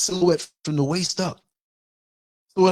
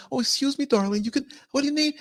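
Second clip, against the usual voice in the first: mock sweet politeness; 9 semitones higher than usual. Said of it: "I'm not, 'Oh, excuse me, darling'".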